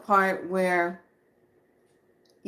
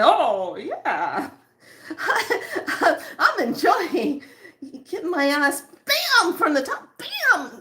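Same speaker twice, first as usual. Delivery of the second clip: high, loud sarcastic glee with a laugh; mock delight; 8 semitones higher, 4 dB louder, slower than usual. Mocking her own tired self, sarcastic glee. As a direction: pretend you're loving every blow, laughing. Mock joy at being hit by energy.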